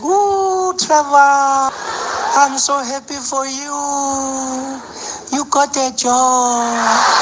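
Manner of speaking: high, delighted granny voice; a proud gran on the phone; lightly hammed-up doting granny; grandmother. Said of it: "And she's like".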